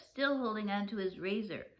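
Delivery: ordinary delivery, nothing marked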